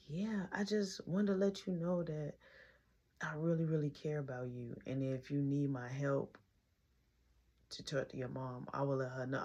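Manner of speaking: low, hushed sincerity; retold caring reply. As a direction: speak gently as if reassuring on the phone. Imitates caller's caring phone reply.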